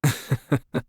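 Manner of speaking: a short polite laugh. Acted. Commissioner amused at Paimon's small talk remark, light chuckle. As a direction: chuckle at the guest's bluntness.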